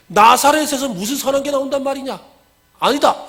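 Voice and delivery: high-pitched incredulous retort; acted scornful disbelief. Nathanael disputing with Philip, incredulous and scornful. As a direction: dismiss the claim outright, pitched high.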